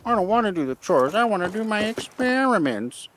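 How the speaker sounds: high, loud whiny brat; spoiled brat voice. Acted. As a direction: whine it like a spoiled kid. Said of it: "Does he sound like kind of a spoiled brat?".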